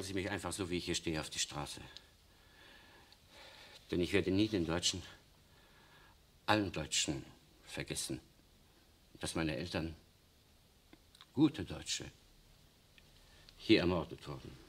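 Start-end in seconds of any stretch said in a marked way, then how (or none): none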